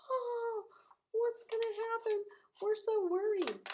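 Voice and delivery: high, anxious mock worry; hammed-up fretting, pitched high; worried friends. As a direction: fret like scared fishermen in a storm. Friends voiced fearing storm.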